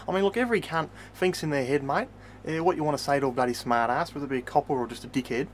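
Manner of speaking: ordinary talk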